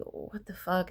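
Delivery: soft, stunned curse; muted disbelief. Retold or imitated. Kids seeing the room, retold in muted disbelief. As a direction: say it under your breath, appalled. Kids' shocked reaction to the room.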